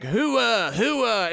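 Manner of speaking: a hint of the Scent of a Woman character; Al Pacino. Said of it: "that character he played in Scent of a Woman".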